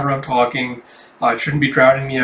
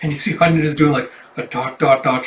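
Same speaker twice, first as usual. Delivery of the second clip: ordinary talk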